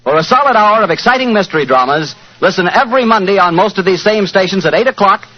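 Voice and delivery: put-on bright old-time radio announcer; vintage radio announcer voice; announcer sign-off. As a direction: close the show like a network announcer.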